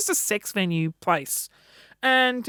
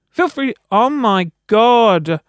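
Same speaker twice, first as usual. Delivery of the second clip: louder excited call over the music; yelling; 8 dB louder, slower than usual. Real, not acted. Reacting to a track over music, loud yell. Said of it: "I'm yelling. Why am I yelling?".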